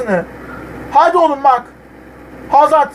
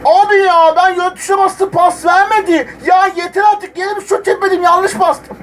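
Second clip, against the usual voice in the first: exasperated complaint; 8 semitones higher than usual. Real, not acted. Complaining about controller mistake.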